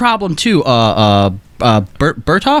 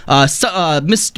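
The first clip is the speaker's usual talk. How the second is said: usual speaking voice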